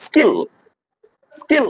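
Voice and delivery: স্কিল with a plain k after the s; unaspirated K after S. Shown as a demonstration. Teacher showing the exception, plain k in স্কিল. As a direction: say স্কিল with no puff on the k. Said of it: "কের উচ্চারণ ক হবে".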